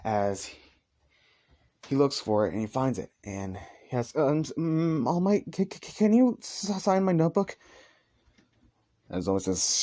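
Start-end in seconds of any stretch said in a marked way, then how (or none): 4.2-7.6 nervous fan. Acted. Starstruck fan asks autograph.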